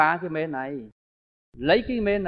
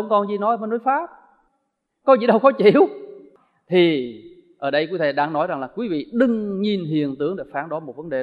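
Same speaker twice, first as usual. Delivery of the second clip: ordinary talk, nothing marked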